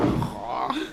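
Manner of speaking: low imitation of a zombie moan; zombie groan. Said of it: "like a zombie going like".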